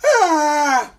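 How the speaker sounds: high, loud mock scream; mom's freak-out scream. Mom freaking out for the kids, high mock scream. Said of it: "My mom's gonna freak out".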